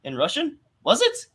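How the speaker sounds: "Was it?" jumping way up in disbelief; mock astonished friend, pitch leaping high; surprised question. Friend hearing he spoke Russian, amazed and high. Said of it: "And he's just like".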